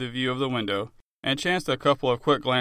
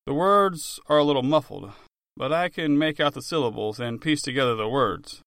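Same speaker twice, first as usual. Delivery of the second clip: ordinary delivery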